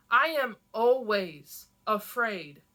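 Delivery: loud and slow confession; powerful voice. A narrator's reading. Zélie confessing her fear to Enon, loud and slow. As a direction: let the truth ring out with force. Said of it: "The power in my voice".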